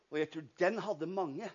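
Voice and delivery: ordinary delivery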